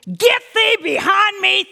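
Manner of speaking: slow loud rebuke; commanding rebuke. Retold or imitated. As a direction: cast it off with authority.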